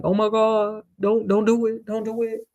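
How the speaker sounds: mock pleading as a cow; half-hearted cartoon begging, level pitch. Cow begging for its life, mock pleading. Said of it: "You got a whole cow over here begging".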